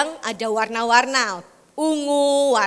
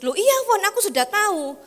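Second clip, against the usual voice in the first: higher, dismissive reply; high offhand son; 8 semitones higher than usual. Retold or imitated. Son brushing off the preacher, higher voice. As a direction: brush it off, pitched up.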